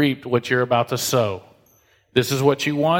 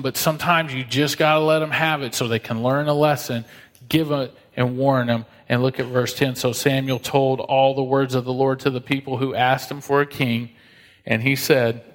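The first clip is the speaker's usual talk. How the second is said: usual speaking voice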